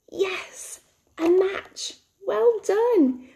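high-pitched, encouraging cheer; delighted praise. Pretend. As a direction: celebrate a child's right answer. Teacher praises children's correct answer.

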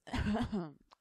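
loud throat-clearing cough. Acted. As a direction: cough harshly in character. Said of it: "a cough with bad her breath".

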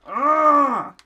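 loud high yelp; startled yelp; pained cry. Real, not acted. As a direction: cry out when hit. Hit by fireball.

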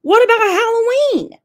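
put-on high girlish whine; girlish protest. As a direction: play the protesting stepdaughter. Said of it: "My stepdaughter goes".

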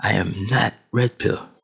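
ordinary delivery

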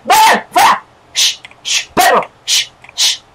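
short high bursts broken by shushes; shooing. Pretend. Player chasing rivals away like dogs, shooing. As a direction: shoo them off in sharp little cries.

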